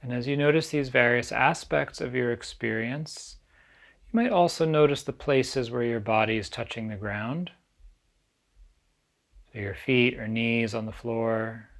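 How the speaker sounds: calm, unhurried meditation guide with long pauses; guided meditation. Acted. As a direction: ease listeners gently into stillness, leaving space between phrases.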